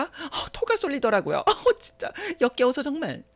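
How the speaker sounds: high-pitched groan of revulsion; disgusted. Retold or imitated. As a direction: recoil as if about to gag. Disgust at the fake kindness. (역겨워서 질색하는 말투)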